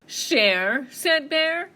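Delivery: loud bear, rising in disbelief; scoffing bear. Acted. Bear hearing mouse's offer, loud disbelief. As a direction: throw the word back as a booming question.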